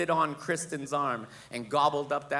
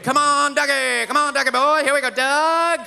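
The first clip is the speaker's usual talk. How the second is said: high, loud holler run together without a break; uninterrupted calling; 9 semitones higher, 8 dB louder than usual. Retold or imitated. Falconer calling the owl for minutes on end, hollering. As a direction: keep hollering for a bird that won't come. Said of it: "that guy called that owl for nine and a half".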